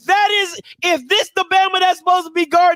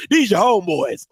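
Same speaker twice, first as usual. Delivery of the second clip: 1 mocking the coach, low; 9 semitones lower than usual. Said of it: "These your people".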